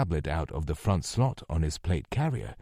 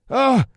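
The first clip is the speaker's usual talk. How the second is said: sharp high cry of pain; pained yell; 9 dB louder than usual. A narrator's reading. Captive yelling in pain after a kick. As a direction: yelp as a nose is broken. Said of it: "he yelled".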